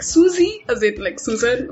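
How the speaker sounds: high, questioning echo of a misheard name; puzzled listener. Retold or imitated. Guest mimics people mishearing her name, puzzled listener. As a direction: mimic someone unsure of the name. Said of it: "and I'm like".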